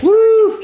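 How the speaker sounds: loud, high, stretched woof; dog bark imitation. Person playing the dog to tease the cat, loud high bark. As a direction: give one big high-pitched bark.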